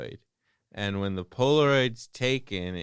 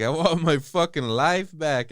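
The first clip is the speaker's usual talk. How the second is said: raised, loud acted declaration; angry demand; 9 semitones higher, 9 dB louder than usual. Killer telling his victim what he wants, loud angry demand. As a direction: push it out loud and high, as a demand.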